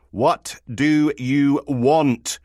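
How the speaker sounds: very slow, loud and high; exasperated demand. Acted. Woken sergeant back to the phone, slow exasperated demand. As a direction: space out each word in exasperation.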